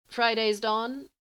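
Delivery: slow, high, crackling question; a voice breaking into static; crackly voice. A narrator's reading. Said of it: "Her voice is becoming more crackly".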